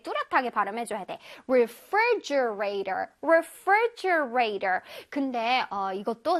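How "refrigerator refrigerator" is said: "refrigerator" with "fri" said crisply and distinctly; slow, hit the "fri" syllable clearly. Shown as a demonstration. Teacher shows kids the clear "fri" in "refrigerator". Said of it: "free 부분을 또렷하게".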